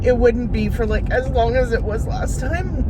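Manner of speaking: breaking down in loud sobs while venting; crying. Real, not acted. Vlogger venting to viewers about her foot injury, crying loudly. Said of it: "I don't mean to cry".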